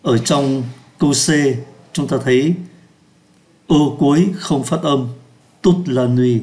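ordinary talk

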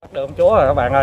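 loud, fast triumphant shout; excited shout. Real, not acted. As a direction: yell it out, thrilled at the catch. Caught the queen bee, shouting. (hét lên phấn khích)

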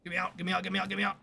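high, rapid-fire repeated plea; frantic high squeal to escape; urgent little panic chant; panicked pleading. Real, not acted.

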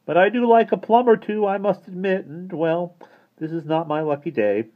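high-pitched complaint; grumbling. A narrator's reading. Mister Turvey complaining about the cake, grumbling. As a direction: bemoan it in a high voice. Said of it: "grumbled Mister Turvey, bitterly eating".

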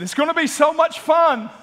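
high, loud enticing lure; mock tempting voice. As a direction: sell it like a sweet temptation. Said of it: "it said".

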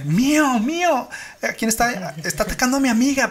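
high, rushed panicked plea; frantic caller. Retold or imitated. Caller telling the operator her pet attacks her friend, frantic. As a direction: imitate the caller in a frenzy. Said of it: "así bien feo".

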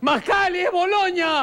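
shouting a warning with a laugh; alarmed shout. Acted.

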